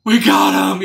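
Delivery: mock triumphant sneer through a wide grin; evil gloating. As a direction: imitate villains gloating, pitch climbing. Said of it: "this evil, shit-eating grin smile".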